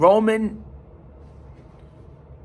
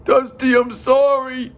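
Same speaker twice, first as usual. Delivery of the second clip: imitating tearful apology; 8 semitones higher, 4 dB louder than usual. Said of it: "Crying and everything".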